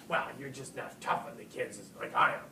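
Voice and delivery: lightly put-on superior colleague, loud on the last words; mock scolding teacher.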